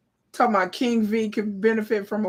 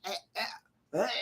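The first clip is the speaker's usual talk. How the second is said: disgusted grunts; 10 dB quieter, much faster than usual. Retold or imitated. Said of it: "over there going".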